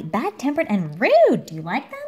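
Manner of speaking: plain reading voice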